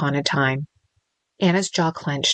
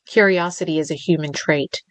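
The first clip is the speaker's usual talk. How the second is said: usual speaking voice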